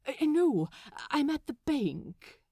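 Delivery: overly proper, a bit rattled; flustered Aziraphale. Acted. Aziraphale on phone.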